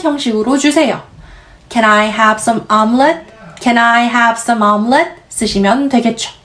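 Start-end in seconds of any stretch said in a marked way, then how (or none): 1.7-5.2 "Can I have some omelet?" said slowly with a rise; slow model question. Shown as a demonstration. Teacher demonstrating how to order an omelet. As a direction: read it out slowly, rising at the end. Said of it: "repeated model".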